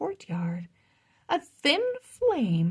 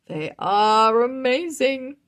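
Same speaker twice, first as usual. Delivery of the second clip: louder, proud declaration; proud king; 4 semitones higher, 7 dB louder than usual. Acted. King Roland praising his dragons, proud and louder. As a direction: boast of the dragons with pride. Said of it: "he said proudly".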